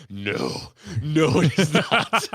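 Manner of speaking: slow, loud, laughing no; laughing denial. Retold or imitated. Brushing off the attendant's compliment, laughing and slow. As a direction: protest through a laugh, slowly. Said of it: "I go".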